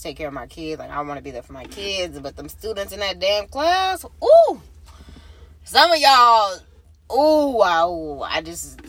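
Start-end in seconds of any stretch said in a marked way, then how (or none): 4.2-4.6 high loud exasperated ooh. Real, not acted. Fed up with loud classmates, high loud ooh. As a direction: let out an annoyed ooh.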